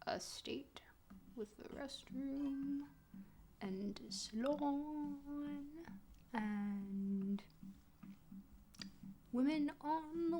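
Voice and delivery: soft, slow whisper to herself. Real, not acted. Streamer reading wall clues to herself in a whisper. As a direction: murmur the clues as you read them.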